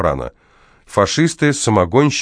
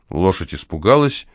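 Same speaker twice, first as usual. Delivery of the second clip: plain reading voice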